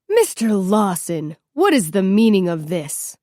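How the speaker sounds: lightly voiced stern principal; stern vice principal. A narrator's reading. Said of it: "Mister Roster, the vice principal".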